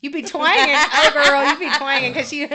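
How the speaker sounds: loud, high, hammed-up Southern twang; mock Southern twang. Teasing her friend about her Southern accent, loud mock twang.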